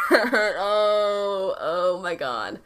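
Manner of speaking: real laugh breaking into a drawn-out groan; laughing, then slow helpless sigh of words; embarrassed laughter. Real, not acted. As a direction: crack up, mortified at your own blunder. Cracks up at her own blunder.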